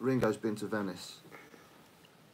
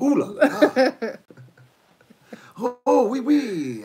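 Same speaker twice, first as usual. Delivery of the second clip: much higher, louder mock French squeal; mock French accent; 10 semitones higher, 8 dB louder than usual. Mocking the French to his friend, high loud fake French. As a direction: squeal it like an over-the-top Frenchman. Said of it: "Every time I speak French".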